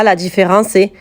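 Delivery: ordinary talk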